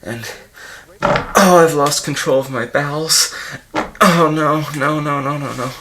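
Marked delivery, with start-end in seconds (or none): 1.0-5.7 loud, high cry of dismay, sinking as it goes; panicked. Acted. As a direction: wail in horror at a sudden disaster. Loses control of his bowels.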